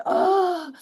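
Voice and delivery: high hammed-up yell of frustration; mock exasperated cry. Speaker reacts to sister's trick, high mock exasperation. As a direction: throw up your hands with a sharp ah.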